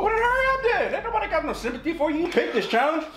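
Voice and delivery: high-pitched teasing. Acted. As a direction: tease him in a squeaky voice. Taunting the friend who eats the pepper.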